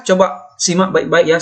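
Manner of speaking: ordinary delivery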